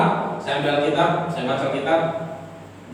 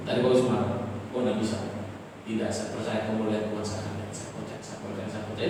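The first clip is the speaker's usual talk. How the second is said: much softer, hushed repeated prayer; whispered prayer; 3 semitones lower, 8 dB quieter than usual. Retold or imitated. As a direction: murmur faith against the fear. Quiet whispered prayer against fear.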